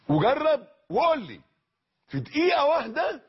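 fiery sermon challenge; rousing call. Real, not acted. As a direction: dare them to try it, rising. Preacher challenges the audience.

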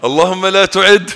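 high, loud pleading prayer; impassioned high dua, loud; retold fervent plea. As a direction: cry out the plea to God again. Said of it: "قلت لما ربنا أزاح الظالم".

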